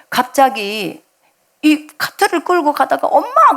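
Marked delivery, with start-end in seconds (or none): 3.1-3.6 sharp high cry of mom; a boy suddenly calling his mother. Retold or imitated. Son crying out to his mother in the store, high and sharp.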